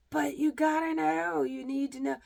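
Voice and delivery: high, loud mock insistence; loud, high-pitched imitation of nagging people; insistent people. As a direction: push it at the listener like a pestering know-it-all. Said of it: "Some people are like".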